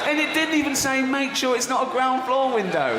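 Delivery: incredulous laughing. Real, not acted. Reading the final item, incredulous.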